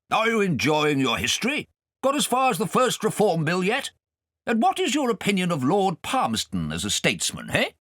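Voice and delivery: put-on high, loud blustering old gentleman; pompous guardian. Guardian quizzing Kay at dinner, pompous and loud. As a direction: quiz the boy grandly.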